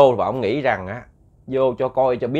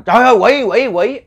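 loud, high panicked shout; terrified cry; 9 semitones higher, 8 dB louder than usual. Acted. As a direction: cry out in alarm at seeing a demon. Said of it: "Ông mới la lên". (la lên hoảng hốt)

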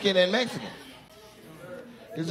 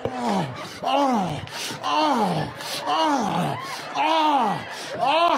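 rising mock wail that builds to a shout; 5 semitones higher, much slower than usual. Comedian fakes a wail that climbs to a shout.

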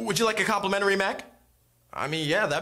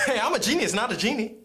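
normal talking voice

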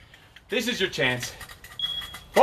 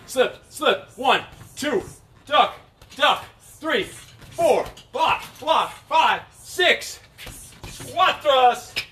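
boxing coach calling combos; 6 semitones higher, much slower than usual. Real, not acted. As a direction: shout the combo to the class.

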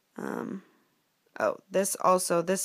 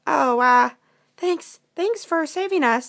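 high, loud, stammered thanks; hesitant chipmunk; 8 semitones higher, 8 dB louder, slower than usual. Acted. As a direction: thank the hero as a flustered sidekick. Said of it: "said Jacques".

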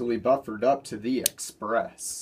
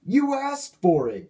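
growled; 10 semitones higher, 3 dB louder than usual. A narrator's reading. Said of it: "growled seven one oh one".

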